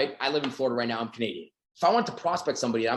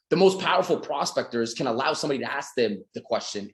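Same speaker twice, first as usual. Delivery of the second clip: ordinary talk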